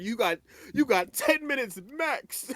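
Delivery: slow, high, stern warning; stern parent. Retold or imitated. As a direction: lay down the law like a strict parent.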